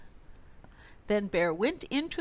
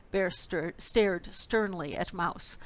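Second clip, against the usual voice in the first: usual speaking voice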